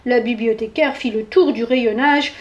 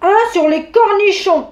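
loud, higher bear's command; ordering; 7 semitones higher, 5 dB louder than usual. A narrator's reading. Bear demanding a book on pickles, bossy and loud. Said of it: "ordonna l'ours".

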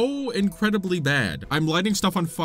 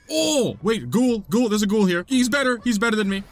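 high, louder cry of alarm; mock panic; 5 semitones higher, 4 dB louder than usual. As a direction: yelp as the enemy gets the better of you. Sudden ghoul attack, then retracted.

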